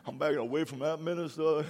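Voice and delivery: mock a timid churchgoer backing off. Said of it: "some churches be going".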